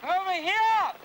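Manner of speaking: high, carrying call; shouting position. Acted. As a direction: shout to guide a rescuer closer. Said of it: "Shout again".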